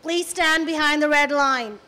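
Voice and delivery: slow, clipped bad American officer accent. Immigration officer barking at her in a bad American accent. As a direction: mimic a curt American immigration officer badly. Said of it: "I'm doing such a bad accent".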